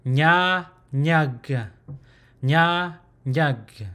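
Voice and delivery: "nya, nyag" with NY as in canyon; tongue tip toward the roof of the mouth for "nya, nyag"; NY consonant. Shown as a demonstration. Teacher demonstrating consonant number seventeen, careful NY pronunciation. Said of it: "this consonant is pronounced".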